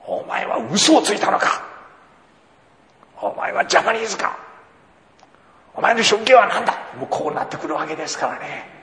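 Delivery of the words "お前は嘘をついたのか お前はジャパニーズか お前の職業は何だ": higher, louder mock interrogation; imagined interrogation. Imagined customs officer grilling a suspect, mock stern. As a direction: bark the questions like a suspicious officer.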